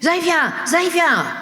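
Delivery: high-pitched mock shouts of players' names; imitated yelling. Imitating kids yelling names at football training, high mock shouts. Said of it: "you can hear them yelling".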